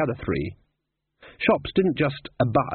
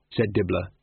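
ordinary delivery

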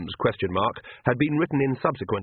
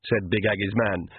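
usual speaking voice

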